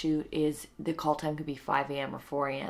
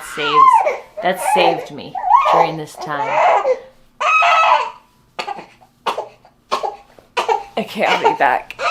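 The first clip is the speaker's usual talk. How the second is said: high, loud laugh-crying; cracking up while near tears; worn-out mom laughing and tearing up; laughing through tears; 13 dB louder, slower than usual. Real, not acted.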